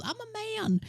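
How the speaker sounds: squeaky high-pitched mock yell; retold yell. Sophie yelling in the library, imitated as a high shriek. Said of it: "when she yells".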